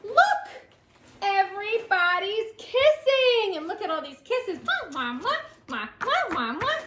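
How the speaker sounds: slow, drawn-out picture-book reading with big swoops; playful storytime narration, high exclamations; reading to children. A narrator's reading. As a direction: draw the little ones in, stretching key words.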